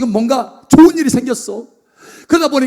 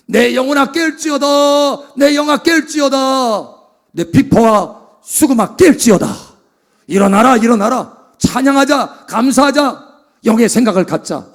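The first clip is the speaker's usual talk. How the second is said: slow, loud summons to wake the soul; rousing call; 3 semitones higher, 4 dB louder, slower than usual. Acted. David rouses his own soul to praise, slow loud call. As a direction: proclaim it slowly, calling the soul awake.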